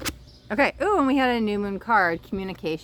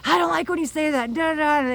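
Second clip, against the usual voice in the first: high-pitched, mildly mock upset protest; retold touchy complaint, pitched up; triggered friend; 8 semitones higher, 3 dB louder than usual. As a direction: imitate someone getting worked up. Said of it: "she was getting so triggered".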